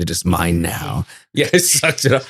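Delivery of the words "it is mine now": menacing whisper. Retold or imitated. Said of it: "snapped his neck and went".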